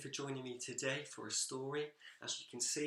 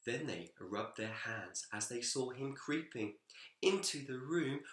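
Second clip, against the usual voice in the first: put-on lively, expressive read-aloud for children; story narration; 3 semitones higher, 4 dB louder, slower than usual. A narrator's reading. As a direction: tell it like a bedtime picture book, bouncing along.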